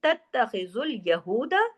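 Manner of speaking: very slow chanted recitation; Quran recitation. Shown as a demonstration. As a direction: recite with idgham, drawn out. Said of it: "बड़ा गुन्ना". (धीमी तजवीद वाली तिलावत)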